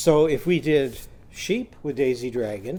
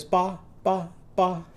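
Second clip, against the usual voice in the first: higher lamb bleat; high little bleat; 4 semitones higher than usual. Shown as a demonstration. Teacher bleating like a little lamb. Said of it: "our sheep are going to go ba ba".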